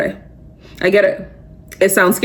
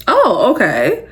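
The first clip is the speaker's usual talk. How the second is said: slow, higher-pitched mock realization; pleasantly surprised; 3 semitones higher, slower than usual. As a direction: draw out a surprised reaction, pitched up. Reacting when it goes well.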